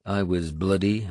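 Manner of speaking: usual speaking voice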